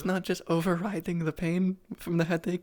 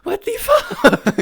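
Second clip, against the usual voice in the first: laughing; 8 dB louder, much slower than usual. Real, not acted.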